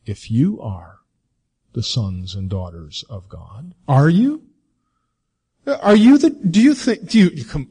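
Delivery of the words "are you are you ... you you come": high, loud, stammering scorn; mocking tempter. The enemy taunting God's children, high mocking jabs. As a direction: jab at the listener with mock doubt. Enemy voiced challenging believers.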